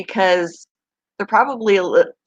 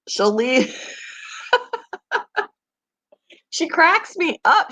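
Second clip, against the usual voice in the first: ordinary talk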